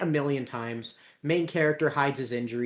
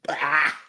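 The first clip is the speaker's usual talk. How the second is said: short, loud burst of frustration, played for laughs; mock frustrated yell; 5 dB louder than usual. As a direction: cut the threat off with a mock yell. Breaks off threat with a yell.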